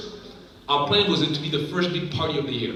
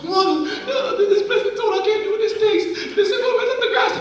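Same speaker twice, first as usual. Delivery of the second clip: laughing complaint; 4 dB louder than usual. Retold or imitated. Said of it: "I told Mom".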